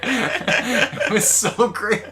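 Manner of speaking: high, loud, drawn out through laughter; real laughing. Real, not acted. Fan raving to co-hosts about an episode, laughing. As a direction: gush while cracking up.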